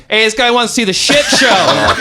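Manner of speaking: high, loud shout; calling out. Retold or imitated. Man announcing a stranger to his friend, calling out loudly. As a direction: holler it across the store, retold.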